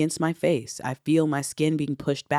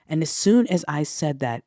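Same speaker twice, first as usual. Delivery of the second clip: usual speaking voice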